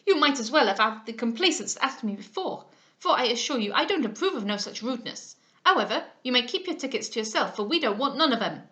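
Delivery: loud, scolding rebuke; angry Madame Duval. Acted. Madame Duval scolds Mr Smith, loud and indignant. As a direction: tell him off indignantly. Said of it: "answered she angrily".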